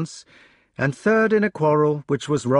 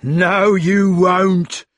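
high, loud angry yell; 7 semitones higher, 6 dB louder, much slower than usual. A narrator's reading. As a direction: bark him down. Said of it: "yelled Sir Meleagrance".

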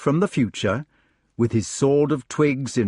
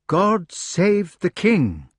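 solemn; 7 semitones higher, much slower than usual. Acted. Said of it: "they said".

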